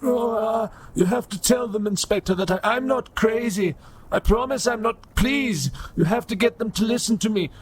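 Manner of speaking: put-on high, pleading old robot priest; old broken robot. Robot insisting to inspector he isn't crazy, pleading. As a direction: beg to be believed in a high, frail voice.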